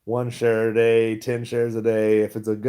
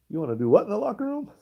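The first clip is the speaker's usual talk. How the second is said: high-pitched, fast, incredulous question; playful shocked reaction to a garbled message; mock shocked disbelief; 11 semitones higher, faster than usual. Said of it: "sending some crazy messages like".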